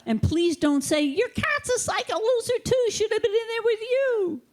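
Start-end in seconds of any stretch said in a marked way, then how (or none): 1.0-4.4 much higher, nasty taunting squeak; mocking high voice. Comedian mocks a tactless visitor insulting the patient, high and taunting.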